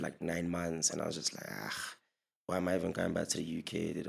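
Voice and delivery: normal talking voice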